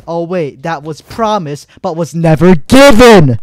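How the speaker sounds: mock realization erupting into a frustrated yell; indignant outburst. Real, not acted. Gamer calling out a broken promise of balance changes, indignant outburst. Sarcastic rant building to a shout.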